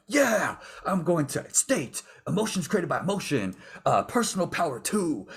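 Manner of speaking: high, loud seminar hype; imitating a pumped-up self-help guru; hyped guru voice. Mimicking his younger self at a seminar, loud and hyped. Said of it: "And I'm like".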